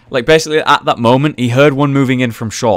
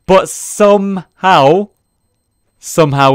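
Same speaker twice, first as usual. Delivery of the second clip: drawn-out excited; 8 semitones higher, 5 dB louder, slower than usual. Real, not acted. Tense comeback round, drawn out.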